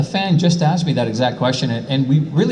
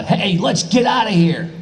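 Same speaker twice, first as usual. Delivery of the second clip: Goku's line; 8 semitones higher than usual. Retold or imitated. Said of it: "there's Goku, and the line was".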